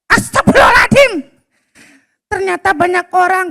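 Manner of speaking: put-on high, bright little boy waking up; child Abdi voice. Boy Abdi waking to a rooster, high child voice. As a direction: play the boy Abdi, puzzled and sleepy.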